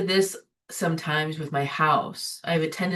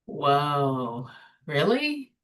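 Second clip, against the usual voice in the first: mock surprise; 3 semitones lower, much slower than usual. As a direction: catch yourself in disbelief. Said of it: "And I'm like".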